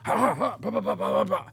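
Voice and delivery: rapid-fire stuttered mouth noises, jumping high; imitated gun-handling noises. Man doing his thing in training, imitated noises. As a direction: act out the noises in bursts of ha and ba. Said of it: "and he goes".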